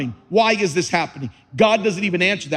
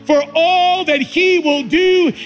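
high, loud and slow, building to a hallelujah shout; preaching at full force; 10 semitones higher, 3 dB louder, slower than usual. Acted. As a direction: lift the church with each word. Preaches the Living Bible verse.

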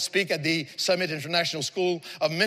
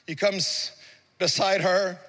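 ordinary delivery

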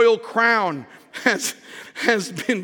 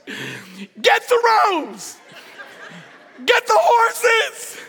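king's command; 10 semitones higher, much slower than usual. Acted. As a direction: bark the king's orders with a laugh. King orders Haman, laughing.

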